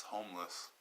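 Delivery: ordinary talk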